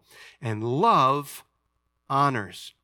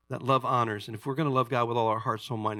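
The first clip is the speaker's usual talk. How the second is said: usual speaking voice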